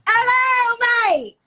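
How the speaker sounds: a big hammed-up Manchester hello; bright over-the-top Manchester greeting; Manchester accent. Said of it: "your best Manchester accent".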